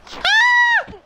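sudden high shriek of fright; startled shriek. Real, not acted. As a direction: shriek as something jumps out. Startled in game.

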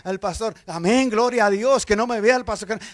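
loud, driving sermon shout; full-force preaching. Acted. Preacher scolds the church about fake conversion, loud and fervent.

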